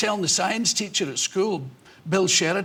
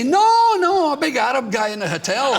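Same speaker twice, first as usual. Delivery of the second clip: desperate blurt; 7 semitones higher, 6 dB louder than usual. Pretend. Comedian tempted at airport check-in, desperate blurt. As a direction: burst out with the forbidden joke answer. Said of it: "I'm just desperate to say".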